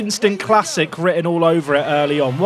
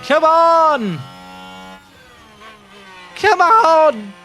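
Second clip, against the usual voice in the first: loud, high drawn-out shout; urging shout; 10 semitones higher, 4 dB louder, much slower than usual. Real, not acted. Driver urging on the car mid-race, shouting.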